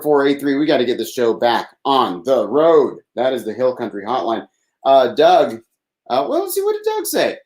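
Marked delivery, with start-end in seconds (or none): 6.1-7.4 much higher, playful reading voice; high mock voice. Reading Doug's message aloud, a high playful voice. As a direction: read it squeaky and playful.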